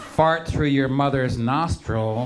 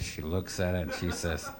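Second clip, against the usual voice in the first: ordinary talk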